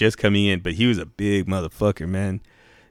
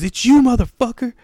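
high, louder accusing shout; big camper accusing the little kid, high yell; mock accusing yell; 6 dB louder than usual. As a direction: point and yell it.

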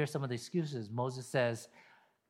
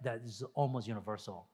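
ordinary delivery

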